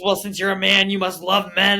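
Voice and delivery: loud, high accusing voice; imitating a father mid-rant; angry dad. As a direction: argue loudly as an irate dad. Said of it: "He gets angry".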